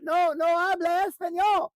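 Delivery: high, loud shouted retort; hammed-up foreign shout; mock shouted reply. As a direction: yell the reply back, mocking. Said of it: "we end up yelling at the person".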